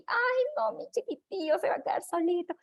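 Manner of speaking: whiny doting mom. Retold or imitated. Mother pitying her little one, high whiny coo.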